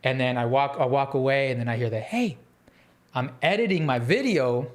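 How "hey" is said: high woman's hey, softly placed; light, lilting high greeting; imitated friendly hey. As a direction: mimic a friendly female call. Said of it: "like, so friendly".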